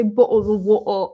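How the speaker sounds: put-on caricatured British accent with swallowed t's; American's cartoon take on British speech; mock British accent. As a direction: drop the t's like an exaggerated Brit. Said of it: "nobody said it like that".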